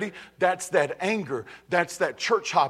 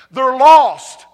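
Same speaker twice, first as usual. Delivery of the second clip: "lost" thrown out much louder; shouted verdict; 4 semitones higher, 12 dB louder than usual. Real, not acted. Preacher shouts at the church that they're lost. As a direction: hit "they're lost" hard.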